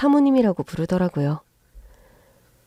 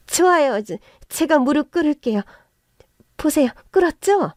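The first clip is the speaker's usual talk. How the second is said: frantic pleading; 6 semitones higher than usual. Acted. In-law kneeling, pleading.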